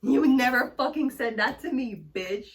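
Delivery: loud, high indignant shout; angry yell. Real, not acted. Scolding the people who judge her, loud angry shout. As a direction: throw it back at them, furious. Ranting at critics.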